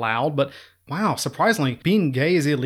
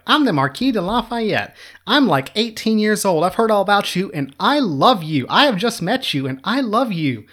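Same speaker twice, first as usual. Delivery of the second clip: put-on higher, breathless starstruck teenager voice; eager young Lafayette; 6 semitones higher than usual. Teen Lafayette gushing to Washington, higher and starry-eyed. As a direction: gush like a lovestruck eighteen-year-old fan. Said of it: "shows up in Washington's camp like".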